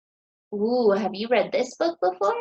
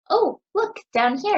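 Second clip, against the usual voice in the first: delighted discovery; 7 semitones higher than usual. Real, not acted. Teacher spots something on the cover.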